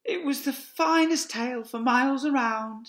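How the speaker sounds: high tender mother fox voice; Mrs Fox voice. Acted. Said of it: "she said between licks".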